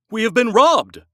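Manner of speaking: loud, high shocked gasp. A narrator's reading. Husband finding the trunk robbed, loud shocked gasp. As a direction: blurt it out in sudden shock. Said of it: "gasped Yankel".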